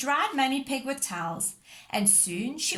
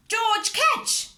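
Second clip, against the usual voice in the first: calling out; 13 semitones higher, 7 dB louder than usual. Acted. Calling across the beach.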